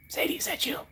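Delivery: hushed, voiceless whisper; playful whisper. Pretend. Streamer playfully calls to a viewer, whispering. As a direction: whisper it as if calling into the dark.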